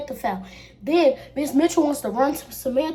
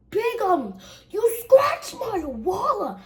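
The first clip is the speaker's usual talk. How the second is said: high, drawn-out imitated whine; mock wail; 9 semitones higher, slower than usual. Friend upset over her scratched wallet, high mock wail. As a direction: wail the accusation slowly, pitched way up.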